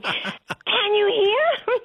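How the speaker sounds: put-on very slow, drawn-out, very high little-girl call; toddler yelling. Grandmother retelling toddler's question again, slow very high child voice. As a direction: stretch it out like a puzzled toddler. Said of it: "she yelled at me".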